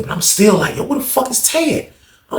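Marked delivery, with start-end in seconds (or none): 0.8-1.9 high, baffled demand; retold exasperation. Storyteller still waiting on his missing friend, retold exasperation.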